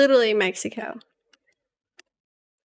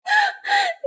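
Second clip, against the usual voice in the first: high squeaky laugh. Real, not acted. Laughing at dish name.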